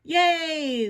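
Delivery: high, loud whoop; long, jubilant yell; cheering. A narrator's reading. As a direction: cheer like a happy crowd. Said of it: "the crowd cheered".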